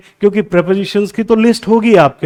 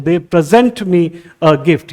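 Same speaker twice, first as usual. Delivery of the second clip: slow example with "present" said pre-ZENT; verb stress; much slower than usual. Shown as a demonstration. Teacher reads an example sentence, verb stress on "present". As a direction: land on the second syllable of "present", taking it slowly. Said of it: "तो ज यहाँ stress दे रहा हूं".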